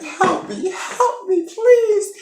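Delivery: very high mock whimper; hammed-up high-pitched begging; pleading for help. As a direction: plead in a tiny squealed voice. Acting Veronica pleading.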